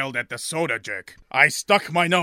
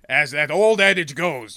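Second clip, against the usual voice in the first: ordinary delivery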